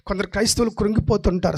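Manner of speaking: ordinary talk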